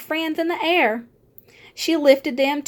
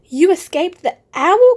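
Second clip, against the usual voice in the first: louder, higher cry of relief; joyful cry; 5 semitones higher, 7 dB louder than usual. A narrator's reading. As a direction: cry out in happy disbelief. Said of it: "cried Stellaluna".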